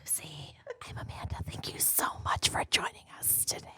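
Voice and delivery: soft ASMR whisper; hushed and breathy, unvoiced. Shown as a demonstration. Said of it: "in this whisper voice".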